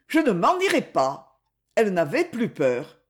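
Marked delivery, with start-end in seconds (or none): 0.1-1.4 shrill refusal. Acted. Woman repeats refusal higher.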